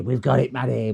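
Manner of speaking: ordinary talk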